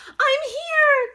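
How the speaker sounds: cried out. Acted. Big Mama Mummy cries out to her baby, loud. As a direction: call out to comfort the child. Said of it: "cried Big Mama Mummy".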